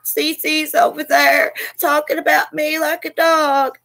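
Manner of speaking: high-pitched mocking complaint; imitating a rival streamer. Streamer imagining what her rival says on live, mock complaint. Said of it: "I can hear her over on her live".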